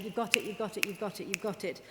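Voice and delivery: normal talking voice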